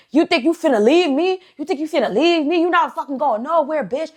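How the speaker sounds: high, heated mock tirade; going off. Man to girlfriend trying to leave, going off in a rant. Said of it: "Just start going off".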